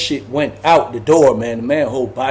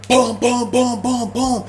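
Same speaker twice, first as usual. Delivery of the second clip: higher boom boom on the door; mock door pounding; 7 semitones higher, slower than usual. Ty beating the cell door down, mock pounding sounds. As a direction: pound out each boom like a fist on steel.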